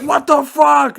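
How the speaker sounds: very high, loud shocked yell. Retold or imitated. Uncle seeing the mess on the couch, shocked yell. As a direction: yell it in disbelief. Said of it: "and he was like".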